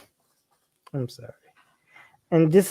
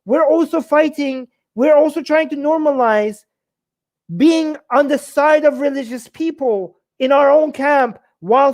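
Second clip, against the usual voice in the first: much higher, building outrage, words pouring out; indignant rant; 8 semitones higher than usual. Real, not acted. Host ranting to viewers about the YouTube ban, rising outrage. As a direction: let the indignation climb with every line.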